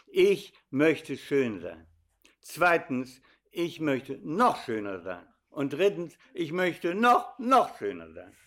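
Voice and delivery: "noch, noch schöner" drawn out and stretched; building emphasis. Shown as a demonstration. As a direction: stress on "noch" growing each time. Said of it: "Ich habe eine dreifache Erklärung". (steigernde Betonung auf „noch“)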